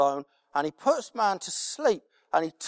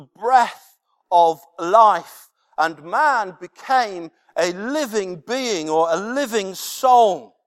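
slow, weighty reading of the verse; scripture reading; 5 dB louder, slower than usual. Acted. Preacher reading Genesis aloud, slow and solemn. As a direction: let each word of scripture land.

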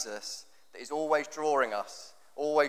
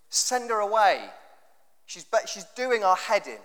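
higher, lightly voiced fed-up disciples; a touch of mock exasperation, pushing her off; exasperated disciples; 5 semitones higher, 3 dB louder than usual. Disciples complaining to Jesus about the woman, mildly exasperated and higher. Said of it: "is what they're saying".

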